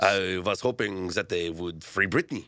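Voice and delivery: badly faked French voice; terrible French accent. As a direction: put on a hammy French accent. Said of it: "That was a terrible French accent".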